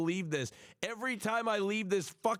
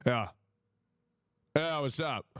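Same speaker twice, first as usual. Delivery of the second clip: curt, minimal replies, lightly imitated; Hassan; 3 semitones lower than usual. Streamer playing Hassan asked about his day, lightly mimicked. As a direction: answer briefly in a lightly borrowed voice. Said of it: "This is my Hassan impression".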